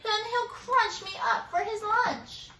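high frightened squeak; squeaky panic. A narrator's reading. Mouse begging koala for help. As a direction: squeak it out in dread. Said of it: "she squeaked".

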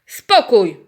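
loud boom for silence; booming command. Acted. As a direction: silence the panic with one shout. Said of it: "huknął mądry koń Maurycy".

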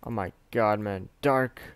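ordinary talk, nothing marked

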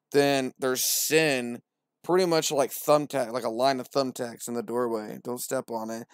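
usual speaking voice

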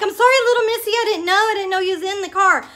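high, pleading retold apology; apologizing to a cat. Driver to the stray from the engine, high apologetic plea.

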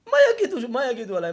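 high, loud wail falling away; hammed-up anguished mother; acted distraught outcry. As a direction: cry out in dread of judgment. Acted mother frets about judgment.

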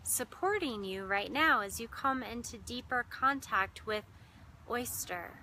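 measured meditation voice with long pauses; guided meditation. Real, not acted. Meditation host guiding listeners into breath and heart, unhurried guided meditation. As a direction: guide listeners inward, lingering on key words and leaving silences.

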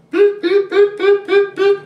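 high, loud chirpy beeps; bird beeps. Pretend. The bird answers the child with loud, high beeping. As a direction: beep like a little bird.

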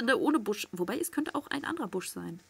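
ordinary delivery

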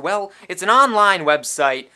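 online website shouted in a pushy ad voice; loud sales bark; loud pitch. Acted. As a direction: push the line hard, almost yelling. Loud shady pitch line.

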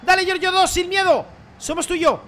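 high, loud urging; cheering on. Real, not acted. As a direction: egg the player on. Urging player on. (animando a gritos)

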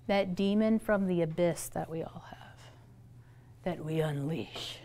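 low, breathy whisper about the demon; spooky whisper. Pretend. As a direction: tell a campfire horror line. Ominous talk of inner demon.

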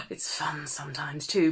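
unvoiced little confession; whispered aside. Real, not acted. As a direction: whisper it like a secret.

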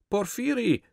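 high delighted call across the platform; joyful call. Acted. Fat man spotting his old friend, calling out high and delighted. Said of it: "rief der Dicke".

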